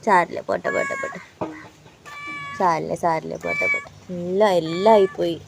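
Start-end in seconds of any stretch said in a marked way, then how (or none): 0.0-3.9 quietly calm the frightened cat; soothing hush. Real, not acted.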